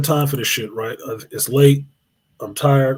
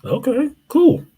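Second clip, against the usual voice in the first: mock casual cool; 12 semitones higher, slower than usual. Mock casual reaction to her.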